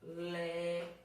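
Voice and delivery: "Colégio" slowly as CO, LÉ, GIO with gaps; syllable by syllable. Shown as a demonstration. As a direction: spell out "Colégio" in held, level syllables. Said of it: "que fica em sílabas". ("Colégio" pausado, sílaba por sílaba, GIO junto)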